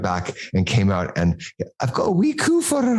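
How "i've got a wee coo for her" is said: very high, hammed-up Scottish falsetto; Mrs Doubtfire-like Scottish lady. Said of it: "I'm loving the channeling of Missus Doubtfire".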